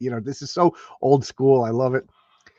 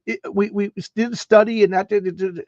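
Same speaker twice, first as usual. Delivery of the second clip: apologetic commissioner; 8 semitones higher than usual. Retold or imitated. Commissioner apologizing to the cop, high and sheepish. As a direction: sheepishly refuse to yell. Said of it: "And he's like".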